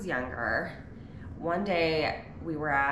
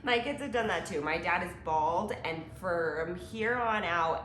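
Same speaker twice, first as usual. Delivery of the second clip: normal talking voice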